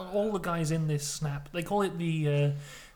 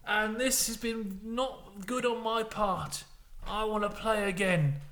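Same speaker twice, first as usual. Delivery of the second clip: higher put-on voice, slow and deliberate; bad Jack Sparrow; 6 semitones higher, slower than usual. Johnny Depp begging for another game, bad Jack Sparrow. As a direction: plead as a badly faked tipsy pirate. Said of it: "he's doing his impression of Jack Sparrow".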